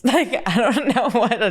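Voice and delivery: talking through a laugh. Real, not acted. Vlogger admits ignorance of leafy greens, laughing as she talks. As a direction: let laughter shake the words.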